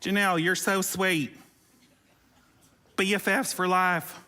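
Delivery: slow, a hint of a Southern woman's accent. A yearbook note to Janelle, in a slow light Southern accent. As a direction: drawl it out lightly, Southern sweet. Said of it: "I'm a Southern woman there".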